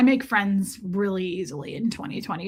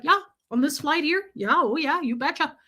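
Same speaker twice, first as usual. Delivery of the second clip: imitated folksy Canadian accent; lightly hammed-up Canadian lilt, like someone out of Fargo; Fargo-style Canadian accent; 3 semitones higher than usual. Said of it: "This isn't Frances McDormand in Fargo".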